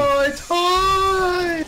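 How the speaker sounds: drawn-out high squeal. Real, not acted. Body flies far, squealing.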